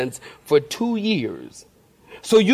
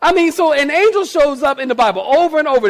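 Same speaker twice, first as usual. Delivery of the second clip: excited; 9 semitones higher, 4 dB louder, faster than usual. Real, not acted. Preacher excited after joke.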